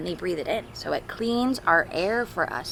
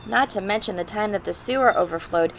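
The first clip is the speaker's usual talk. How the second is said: put-on clear, louder reading-to-children voice; storybook read-aloud; 3 semitones higher, 5 dB louder than usual. Teacher reading a picture book to the class, storybook voice. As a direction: read it like a father's letter to his kids.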